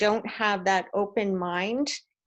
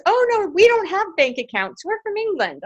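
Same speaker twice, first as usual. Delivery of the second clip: mother's amused answer; 10 semitones higher, 6 dB louder than usual. Retold or imitated. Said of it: "She goes".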